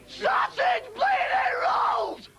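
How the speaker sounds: high, slow, indignant yell; slow exasperated shouting; slow outraged shout. Acted. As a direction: spit out each word. Ranting comic character.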